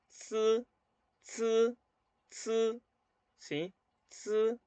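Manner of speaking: "zi" as a buzzed ts with tongue tip at the upper teeth; TS sound. Shown as a demonstration. Teacher showing learners tongue on upper teeth, TS sound in "zi". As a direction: say "zi" like a drawn-out, high, level ts syllable. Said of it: "vamos a sacar un sonido de T S".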